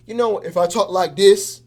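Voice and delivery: loud, put-on demo voice; a loud vibe demo; one certain vibe. Shown as a demonstration. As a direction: say it loudly in an odd voice to show a mood. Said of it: "I sound kinda like a certain way".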